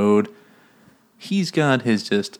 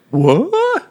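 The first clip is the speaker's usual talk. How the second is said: squeaky, high, loud comic disbelief; mock shock; 5 dB louder than usual. Heroine learns he is a virgin, hammed-up shock. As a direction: shoot way up in pretend astonishment.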